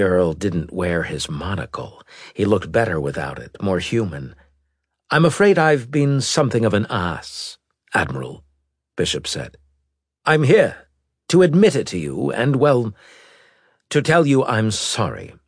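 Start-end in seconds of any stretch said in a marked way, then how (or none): 5.1-15.3 apologetic noble. Acted. Earl apologizes.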